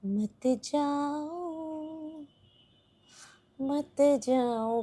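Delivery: soft, long drawn-out plea; soft coaxing. Real, not acted. Owner pleading with the cat not to leave, soft coaxing. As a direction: draw the words out gently and softly.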